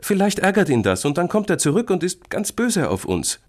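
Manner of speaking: high, loud worried warning; alarmed small boy. Acted. Boy fears creature's revenge, alarmed. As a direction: warn urgently in a child's voice.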